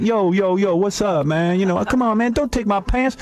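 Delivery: high-pitched mock street talk; imitating own youthful slang. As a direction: parody your younger self pleading in slang. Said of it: "I used to talk the way you're talking".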